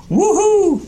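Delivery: whooping high and loud; gleeful yell. A narrator's reading. Ghost showing off to Jim, gleeful whoop. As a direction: let out a wild whoop of delight. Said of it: "he yelled".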